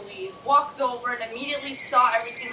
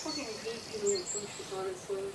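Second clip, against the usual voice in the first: slow, soft annoyed mutter; grumbling mutter; 3 semitones lower, 12 dB quieter than usual. Real, not acted. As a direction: grumble it under your breath. Player grumbles after being charmed.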